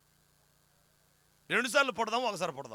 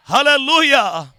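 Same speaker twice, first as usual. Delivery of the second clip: normal talking voice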